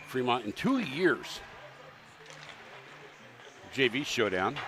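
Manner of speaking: loud, booming arena intro; hype announcer. Acted. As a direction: pump up the crowd like a hockey arena announcer.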